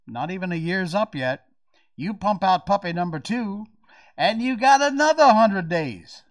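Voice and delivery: a hint of a Southern accent. Real, not acted. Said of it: "Why am I falling into a Southern accent?".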